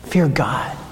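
hushed whispered command. Acted. Preacher giving the bottom line, whispered. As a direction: whisper it like a secret truth.

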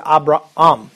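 Abraham as "ah-bra-AHM"; both A's pronounced. Shown as a demonstration. Teacher explains the double A, both sounded. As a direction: give Abraham a separate second A before the M. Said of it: "you pronounce both of them".

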